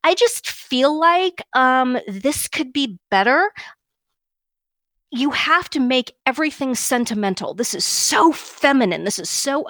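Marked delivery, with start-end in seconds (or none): 0.0-3.5 high, slow, tentative imitation rising on "better"; mock uptalk affectation. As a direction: mimic someone hedging a statement into a question. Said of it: "this business with".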